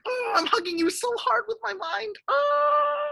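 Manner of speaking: very high, playful squeeze noise; mock hugging squeal. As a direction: squeal as if pretending to hug hard. Give you guys a mind hug.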